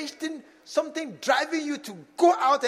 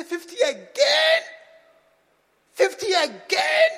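slow, loud, incredulous "Fifty again?"; exasperated mock complaint; 8 semitones higher, 9 dB louder, slower than usual. Pastor acting a fed-up member who sees fifty again, slow and loud.

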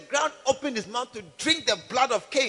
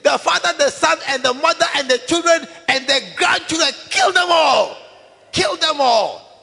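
blood shouting for revenge; 5 semitones higher, 8 dB louder than usual. Acted. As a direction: roar out the curse on the whole family. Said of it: "your blood will be shouting".

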